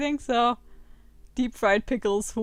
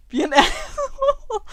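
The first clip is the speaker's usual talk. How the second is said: much higher, slow laughing read of the choice; laughing disbelief; 12 semitones higher, 4 dB louder than usual. Real, not acted. Gamer reading the absurd dialogue option, laughing.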